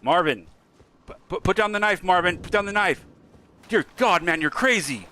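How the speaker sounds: high, loud hammed-up panicked yelling; mock panic. To Marvin with the knife, high loud mock panic. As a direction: yell in fake terror at a man with a knife. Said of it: "No, I'm kidding".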